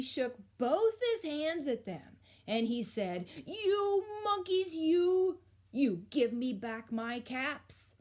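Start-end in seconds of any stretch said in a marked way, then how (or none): 3.5-7.9 loud angry scolding, high drawn-out start; storybook peddler getting cross. A narrator's reading. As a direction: scold the monkeys, loud and indignant. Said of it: "This made the peddler angry".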